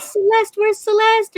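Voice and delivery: put-on high, eager child's voice calling her name; little boy calling out. Imitating her best friend's young son calling for the dinosaur lady. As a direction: call for her like an excited little kid. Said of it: "he'll be like".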